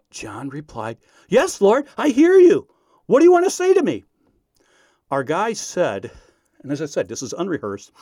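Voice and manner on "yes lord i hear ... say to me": high, loud answer to heaven; eager calling out. Retold or imitated. Pranked student answers the Lord on radio, eager shout. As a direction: imitate a believer crying out, climbing higher and louder.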